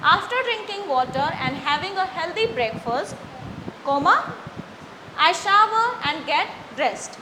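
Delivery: slow model reading, rising at the comma and falling at the end; read with pauses. Shown as a demonstration. Teacher models punctuation-aware reading to the student. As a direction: pause on the comma, close the sentence firmly. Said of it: "comma और full stop के ऊपर हमें focus करना है".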